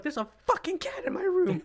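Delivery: high, loud exclamation of disbelief; shocked outcry. Retold or imitated. Podcaster retelling finding a stray on the bed, shocked cry. Retelling discovering a cat in room.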